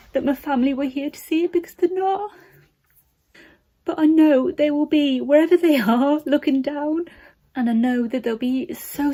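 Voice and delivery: fighting tears while talking about family; tearful. Real, not acted. Nursing graduate missing her late nan and mum, tearful. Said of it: "it's emotional".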